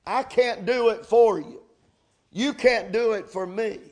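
ordinary delivery

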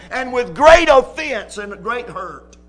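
ordinary talk, nothing marked

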